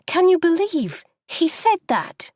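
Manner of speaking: high, disbelieving complaint; indignant outburst. Acted. Wife venting to husband in the cab, high and indignant. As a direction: demand an answer in outrage.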